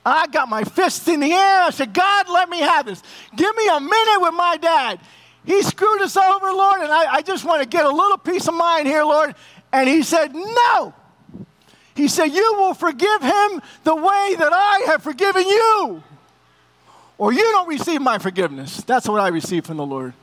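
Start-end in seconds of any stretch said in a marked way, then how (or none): 1.9-9.3 higher, raised pleading with God; angry plea to God. Retold or imitated. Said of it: "I'm yelling out loud". 10.3-18.8 higher, firm divine command; God's stern answer. Retold or imitated. God answers him firmly.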